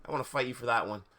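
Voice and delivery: plain reading voice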